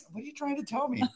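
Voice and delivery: high, pleading confused dog. Acted. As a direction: voice a baffled dog questioning its trainer. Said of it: "I'm like that confused dog".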